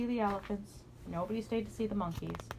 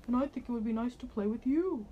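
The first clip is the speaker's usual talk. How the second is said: friendly dinosaur voice; 4 semitones higher than usual. Acted. Dinosaur speaks to Danny.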